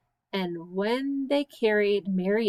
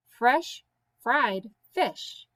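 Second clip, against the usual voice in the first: slow; much slower than usual. Shown as a demonstration. Tongue twister word shuffles.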